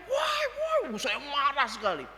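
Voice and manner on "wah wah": yelling at the driver who ran off; shouting. Retold or imitated. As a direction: cry out after the fleeing driver. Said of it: "Saya teriak".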